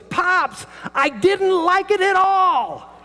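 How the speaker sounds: put-on high, loud, slow kid voice; imitating grandson. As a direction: drag it out like a kid who hated it.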